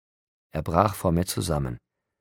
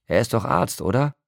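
angry; 6 semitones higher, 3 dB louder than usual. A narrator's reading. Jacob's angry follow-up.

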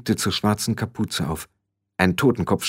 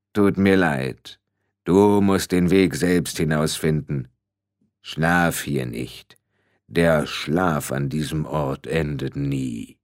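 slow, low, grave warning; ponderous Death, sinking lower and slower; heavy-voiced Death; 6 semitones lower, slower than usual. A narrator's reading. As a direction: intone it deep and drawn out. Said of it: "Die Stimme war schwer".

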